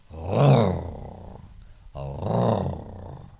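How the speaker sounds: long, loud dog-like roar, falling away; drawn-out imitation animal roar; imitated wild dog alarm call. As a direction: mimic a wild dog's alarm growl. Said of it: "they did that sort of alarm call".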